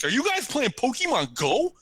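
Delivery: high, strange put-on voice of an eager stranger; odd stranger's voice. Man asking the friends about Pokemon Go, in a high odd voice. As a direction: mimic the odd guy who marched up, pitched high. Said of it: "That's pretty much how he talked".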